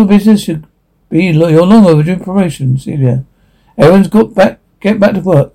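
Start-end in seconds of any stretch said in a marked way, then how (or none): none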